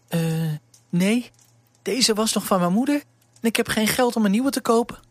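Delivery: poor servant girl, lightly voiced; young girl Elise.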